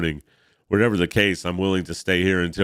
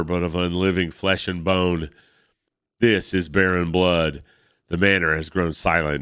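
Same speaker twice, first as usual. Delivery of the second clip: usual speaking voice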